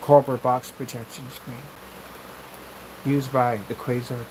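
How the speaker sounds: low, slow monotone presenter; boring presenter voice. Shown as a demonstration. Demoing a boring presentation voice for the camera. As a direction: drone through it like a dull demo host. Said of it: "You don't want to talk like that".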